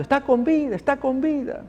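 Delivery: high, joyful cry of relatives; joyful shouting. Retold or imitated. Relatives crying out that the girl lives, high and joyful. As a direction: call it out high with joy. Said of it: "y todos gritando".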